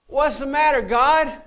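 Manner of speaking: high, loud, whiny cry of what's the matter; a hammed-up indignant plea to heaven; exasperated complaint to God. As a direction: act out a frustrated believer griping at God. Imagined believer complaining to God.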